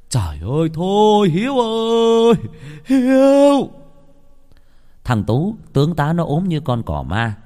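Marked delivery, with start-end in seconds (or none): 0.1-3.7 high, drawn-out pleading yell; crying out. A narrator's reading. Father yelling at Hiếu to stop fighting, crying out. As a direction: call him back with long, loud wails. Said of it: "Ông la lên".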